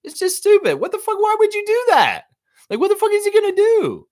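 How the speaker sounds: high, loud incredulous yelling; outraged rant. Real, not acted. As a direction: blow up in disbelief at a dumb decision. Ranting about a player's move.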